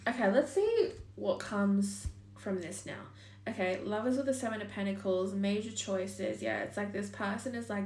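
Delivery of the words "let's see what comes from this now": ordinary talk, nothing marked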